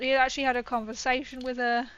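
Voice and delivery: normal talking voice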